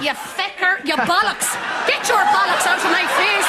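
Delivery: fast, rising mock tirade; shrill outburst. Old woman spitting out the swears at the priest, high and loud. Mrs Doyle swearing in outrage.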